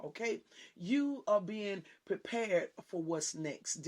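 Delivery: ordinary talk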